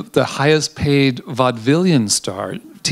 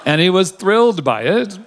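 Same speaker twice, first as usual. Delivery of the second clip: laughing through the words, higher pitched; 7 semitones higher than usual. Real, not acted. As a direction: let a real laugh break in. Amused at the story.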